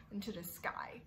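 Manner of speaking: soft breathy whisper for effect. Acted. Teacher hushing the class in wonder, whispering. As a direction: drop to a hushed whisper.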